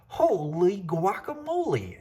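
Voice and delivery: high, slow kitten exclamation; startled exclamation. Acted. Kitten startled by his reflection, high and amazed. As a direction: gasp it out, amazed at the mirror. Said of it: "exclaimed Skippy John Jones".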